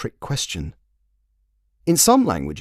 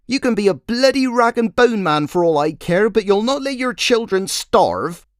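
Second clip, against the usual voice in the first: scolding fishwife; 12 semitones higher, 4 dB louder than usual. Acted. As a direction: nag him down the street, high and loud.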